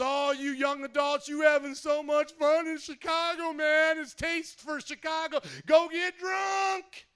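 hammed-up shout, slow on go get drunk; mock party voice. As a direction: egg them on like a reckless buddy.

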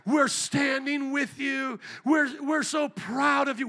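slow, high, loud fake admiration; mock gushing praise. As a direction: gush mockingly, stretching the words. Mocking supporters' gushing praise.

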